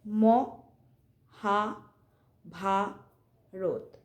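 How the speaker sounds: "Mahabharata" as four isolated syllables with long pauses; চার বারে উচ্চারণ. Shown as a demonstration. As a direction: count out "Mahabharata": mo... ha... bha... rot. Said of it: "মানে আমরা কবারে বললাম?". ("মহাভারত" থেমে থেমে চার বারে ম... হা... ভা... রত)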